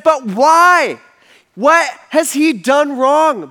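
high, loud, drawn-out protest. Retold or imitated. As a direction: cry out the question in disbelief. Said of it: "And he said".

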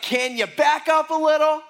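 a high, loud call across the road; mock the road worker shouting at the driver; impatient worker yelling. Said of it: "And he's like".

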